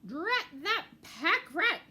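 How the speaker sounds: loud, slow cross outburst; angry curse. Acted. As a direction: spit out the curse at the rat. Mouse fell, cursing.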